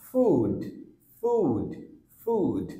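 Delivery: ফুড with a held oo; long oo in food. Shown as a demonstration. Teacher modelling ফুড. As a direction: stretch the oo in ফুড. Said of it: "same ভাবে".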